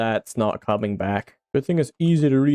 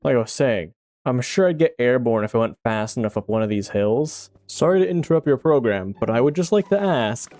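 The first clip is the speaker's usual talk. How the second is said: ordinary talk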